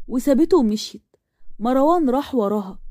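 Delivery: ordinary delivery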